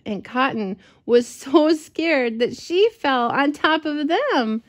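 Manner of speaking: ordinary delivery, nothing marked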